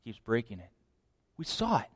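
ordinary delivery

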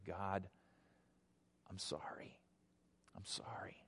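hushed, low confession to God; near-whispered apology, pleading for mercy; penitent plea. Acted. Sinner kneeling at the cross begs God's mercy, hushed and penitent. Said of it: "throw yourself on his mercy and say".

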